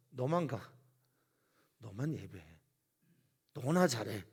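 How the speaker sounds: loud, scornful jeer; Pharaoh's mocking taunt. Pharaoh taunting Moses, loud scornful jeer. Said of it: "이런 류의 말들을 하지요".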